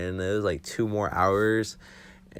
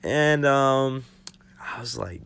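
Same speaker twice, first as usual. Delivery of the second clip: usual speaking voice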